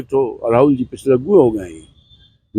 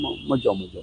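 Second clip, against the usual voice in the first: softer, lower mutter of a parent's warning; imitated parental warning; 4 semitones lower, 13 dB quieter than usual. Politician voicing parents forbidding evening outings, softly imitated warning. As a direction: lightly voice a guardian saying no, trailing away. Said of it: "papa mummy या जो guardian है मना करते हैं".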